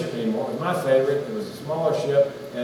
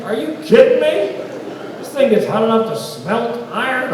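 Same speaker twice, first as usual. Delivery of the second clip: incredulous; 6 semitones higher than usual. Real, not acted. Comedian mocks the Easy Bake commercial, incredulous. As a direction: scoff at the ad in mock shock. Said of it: "mocking the commercial".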